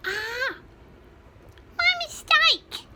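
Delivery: high, tiny peeping chick voice. A narrator's reading. Smallest chicken admits a mistake, high tiny peep. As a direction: squeak it like a little chick. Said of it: "he peeped".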